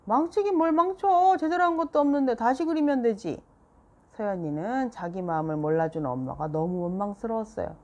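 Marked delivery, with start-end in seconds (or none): 0.1-3.4 angry retort. A narrator's reading. Mom snapping back at Seoyeon in their quarrel. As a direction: bite back at the child.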